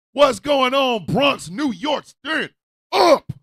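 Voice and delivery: rally the New York crowd; crowd callout. Real, not acted. Greeting the Bronx in the audience, loud callout.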